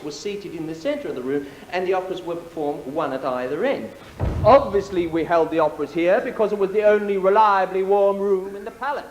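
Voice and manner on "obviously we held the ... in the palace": louder, pushing the words out to the back of the hall; loud aside. Acted. Host booming to the gala audience about the warm room. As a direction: project it boldly over the room.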